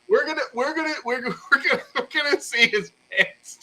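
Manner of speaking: high, laughing, slowing at the end; can barely get it out for laughing; giggling. Real, not acted. Host giggling about the pants.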